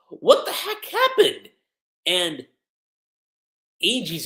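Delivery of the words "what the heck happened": high-pitched, drawn-out shock; startled disbelief. Acted. Onlooker reacting to Yakuto being hit, high-pitched disbelief. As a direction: act out a stunned onlooker, voice leaping up.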